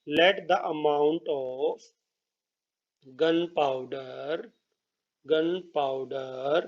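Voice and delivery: very slow, drawn-out syllables; slow dictation. Real, not acted. Teacher writing a statement for students, slow dictation. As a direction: stretch the words to match the writing.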